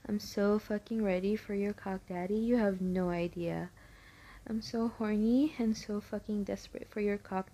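normal talking voice

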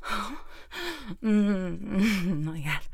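ordinary delivery

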